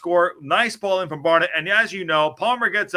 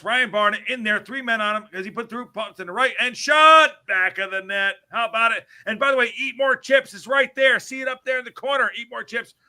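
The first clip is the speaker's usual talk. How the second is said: higher, lively play-by-play call of the goal; excited commentary; 6 semitones higher, 4 dB louder than usual. Acted. Host calling the goal for the podcast, excited commentary.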